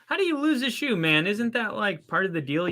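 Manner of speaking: high, disbelieving exclamations; can't believe what you see; surprised. Real, not acted. Still amazed at lost shoe.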